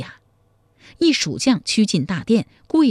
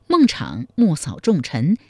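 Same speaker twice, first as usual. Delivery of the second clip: usual speaking voice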